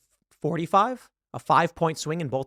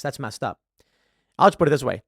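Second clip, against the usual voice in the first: normal talking voice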